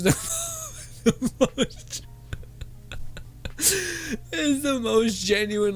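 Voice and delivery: slow, high words through giggles; real giggling as she explains; laughing while talking. Real, not acted. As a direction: still laughing, barely getting it out. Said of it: "Oh, I'm teared up".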